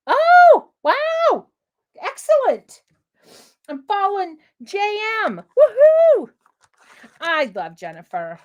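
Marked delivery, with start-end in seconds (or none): 0.1-2.8 much higher and louder, a bright cry of delight; delighted wow. Real, not acted. Delighted reaction to viewer. 5.6-6.3 high, loud whoop; cheer. Real, not acted.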